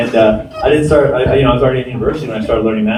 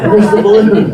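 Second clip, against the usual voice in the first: higher, louder fixated spectator; mock audience demand; 5 semitones higher, 6 dB louder, slower than usual. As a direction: lightly mimic a crowd demanding an answer. Mimicking audience's reaction to anything else.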